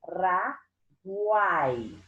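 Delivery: "Paraguai" in three slow, separate syllables, pa - ra - guai; separação silábica. Shown as a demonstration. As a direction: break "Paraguai" apart, keeping guai as one long syllable. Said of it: "vejo que permanece na mesma sílaba". ("Paraguai" separado devagar: pa, ra, guai)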